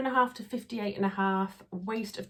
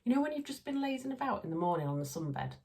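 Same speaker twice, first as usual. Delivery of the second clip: ordinary delivery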